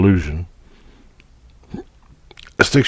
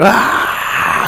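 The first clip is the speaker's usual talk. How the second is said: startled cry. Retold or imitated. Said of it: "And you're like".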